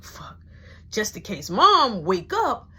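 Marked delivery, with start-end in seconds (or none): 0.0-0.4 breathy hushing whisper so mama stays asleep; urgent whisper. Retold or imitated. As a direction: shush him in a tight whisper. Alone at night, scared.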